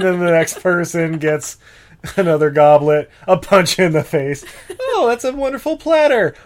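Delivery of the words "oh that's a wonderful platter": mock grateful peasant.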